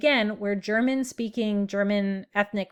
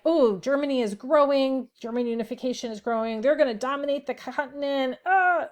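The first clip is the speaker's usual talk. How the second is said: higher, lightly anxious voice; mock French worry; 5 semitones higher than usual. Lecturer voicing the French fearing a growing Germany, mock worried. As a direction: play the nervous French, half-joking. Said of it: "So the French were like".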